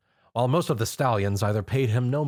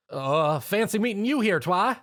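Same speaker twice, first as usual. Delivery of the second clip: embarrassed high stammer, caught out; stammer, caught off guard; 9 semitones higher than usual. A narrator's reading.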